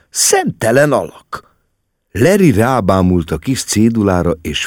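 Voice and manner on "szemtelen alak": high, drawn-out indignation; indignant exclamation. A narrator's reading. Mama reacts to what the officer wrote, indignant. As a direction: stretch it out in high outrage. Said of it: "kiáltotta méltatlankodva".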